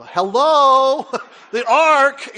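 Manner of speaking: loud, high "hello, the ark"; exasperated reply to wife; mock exasperated shout. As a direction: shout it like it's obvious. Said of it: "I go".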